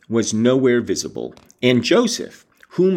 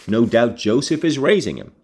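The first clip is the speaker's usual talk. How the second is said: usual speaking voice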